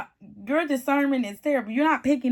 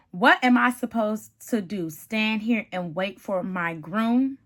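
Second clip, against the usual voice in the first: normal talking voice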